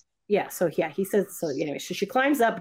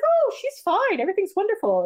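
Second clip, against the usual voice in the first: high, airy mock reassurance; breezy reassurance; 13 semitones higher than usual. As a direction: imitate a doctor brushing off worries, pitched way up. Acting out doctor's dismissive reassurance.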